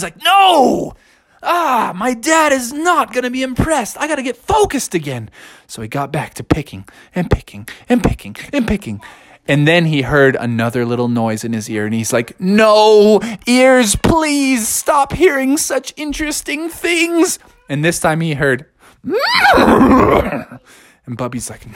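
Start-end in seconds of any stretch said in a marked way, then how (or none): 0.2-5.3 dismayed cry. Acted. Bear sees dad's pile. 12.4-17.4 pleading cry. Acted. Bear begs his ears. 19.0-19.5 horse whinny. Acted. Horse noise.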